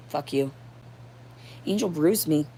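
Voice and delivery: ordinary talk, nothing marked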